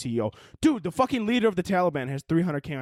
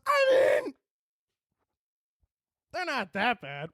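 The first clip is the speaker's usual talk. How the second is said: mock Twitter voice; 9 semitones higher, slower than usual. Host mocks Twitter shrugging off the Taliban, in a put-on shrugging voice. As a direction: play the company brushing it off in a put-on voice. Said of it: "Twitter goes".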